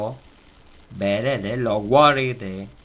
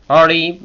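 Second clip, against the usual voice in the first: "Ori" high and loud; forceful raised stress on "Ori"; accented; 6 semitones higher, 10 dB louder than usual. Shown as a demonstration. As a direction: hit "Ori" hard and high. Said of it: "solamente ori, acentuado".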